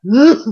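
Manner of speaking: mock exasperated grunt, rising sharply; pent-up "uh" of mock frustration; exasperated growl. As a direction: act out itching to claw someone. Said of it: "kudu tak kerawo".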